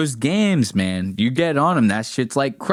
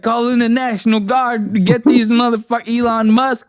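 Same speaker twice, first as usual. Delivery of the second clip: high-pitched, loud mock tirade; hammed-up angry gamer; pissed-off rant imitation; 9 semitones higher, 5 dB louder than usual. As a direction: rattle off frantic mock demands. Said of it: "He's so pissed".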